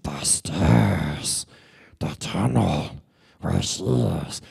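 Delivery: low, slow creature voice; Gollum. Retold or imitated. Creature leads them to the stairs, creepy low voice. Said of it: "Gollum leads them to the stairs".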